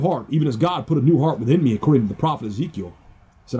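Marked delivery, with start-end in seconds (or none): 3.0-3.6 whisper. Real, not acted.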